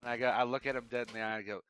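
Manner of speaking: ordinary talk, nothing marked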